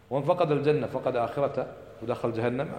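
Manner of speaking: ordinary delivery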